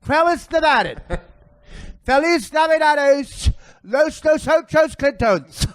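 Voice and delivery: high, slow effeminate voice with mock Spanish; hammed-up effeminate fake Spanish, high and slow; Tim Kaine impression. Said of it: "my Herman Tim Kaine character".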